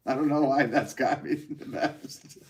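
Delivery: slow words breaking into laughter; real giggles creeping into the words; laughing. Real, not acted. Streamer tickled by a viewer's comment, laughing as they talk.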